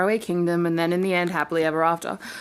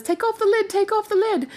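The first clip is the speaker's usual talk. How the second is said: little hare voice; 11 semitones higher than usual. Acted. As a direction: sing it out like an eager little bunny.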